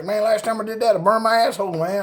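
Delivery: high, mumbly imitation of Scooter; lightly voiced Scooter; Boomhauer-style drawl. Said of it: "'cause he talked like Boomhauer".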